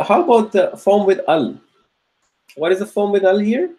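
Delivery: ordinary talk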